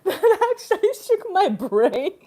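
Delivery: speaking through tearful laughter; loud, high, laughing out the words; laughing crying. Real, not acted. Laughing too hard.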